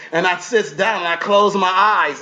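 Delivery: ordinary delivery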